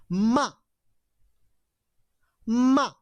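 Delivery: high, exclaimed "Ma", falling at the end; emphatic high "Ma" as an exclamation; fourth tone. Shown as a demonstration. All together after the teacher, high exclaimed "Ma". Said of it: "Tone number four".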